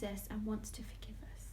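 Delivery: soft hushed reassurance; tender whisper. A narrator's reading. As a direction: gently reassure the children. Tender gospel message.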